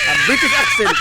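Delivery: high squeal of laughter through the words; laughing repeat. Real, not acted. Co-host laughs at the host's joke about his hoarse voice, laughing.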